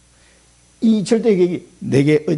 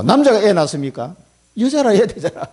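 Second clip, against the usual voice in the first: ordinary delivery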